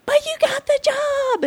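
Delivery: very high, airy squeal; squeaky, half-whispered excitement; mock-gleeful reveal. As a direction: imitate springing surprise good news. Imitates coordinator's sudden good-news reveal.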